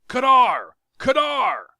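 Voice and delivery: high, loud summoning shout; calling the guard. Acted. Tario shouting for the guards in alarm.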